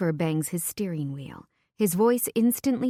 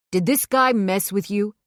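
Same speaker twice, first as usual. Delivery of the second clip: a sharp, louder male challenge; aggressive; 3 semitones higher, 6 dB louder than usual. A narrator's reading. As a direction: confront him, protective and hostile. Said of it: "Vincent's aggression".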